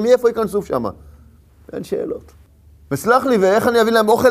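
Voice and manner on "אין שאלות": hushed refrain. Acted. Refrain, no questions asked.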